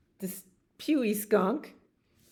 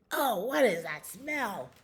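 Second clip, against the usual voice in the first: higher put-on voice, swooping down; creature in the cave; 5 semitones higher than usual. Hidden cave creature smells skunk, high disgusted voice. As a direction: exclaim it in disgust at the stink. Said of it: "something that was in the cave said".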